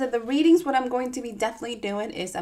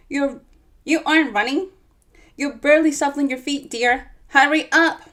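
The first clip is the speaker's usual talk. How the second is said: put-on louder nagging old lady; bossy granny; 4 semitones higher, 6 dB louder than usual. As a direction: chide her to keep up. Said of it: "said Minnie".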